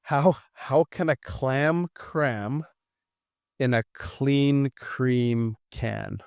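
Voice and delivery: careful, very slow, word by word; very slow tongue twister. Shown as a demonstration. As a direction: pick through each word slowly. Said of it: "I'm not saying that one fast".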